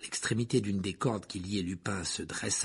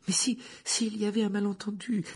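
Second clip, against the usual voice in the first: high, hesitant pleading voice; pleading girl; 11 semitones higher, 4 dB louder than usual. Acted. Student dares to object, high and pleading. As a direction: stammer a plea up high.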